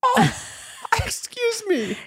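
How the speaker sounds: squeaky high, hammed-up outrage; mock offended. Real, not acted. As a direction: act scandalized at the insult. Offended by music criticism.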